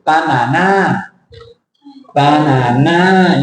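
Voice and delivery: banana slow, three equal A syllables; Thai-style banana. Shown as a demonstration. Teacher imitates Thai students saying it. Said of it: "for Thai people".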